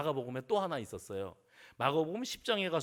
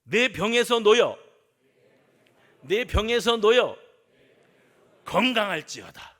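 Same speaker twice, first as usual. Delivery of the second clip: forceful declaration; 3 semitones higher, 12 dB louder than usual. Shown as a demonstration. Preacher leading the congregation to repeat, forceful proclamation. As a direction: belt it out for the congregation. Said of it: "해봅시다".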